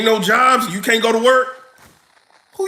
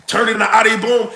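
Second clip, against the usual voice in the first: usual speaking voice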